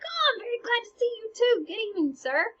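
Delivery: high, bright-voiced happy greeting to his best friend; excited greeting. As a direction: squeal it like seeing your best friend. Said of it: "Hamlet's like".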